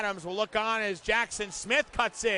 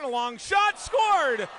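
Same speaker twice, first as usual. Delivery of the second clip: higher, louder goal call; excited goal call; 6 semitones higher, 6 dB louder, slower than usual. Real, not acted. Goal call in hockey play-by-play.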